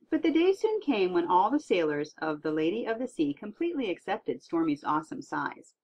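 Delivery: usual speaking voice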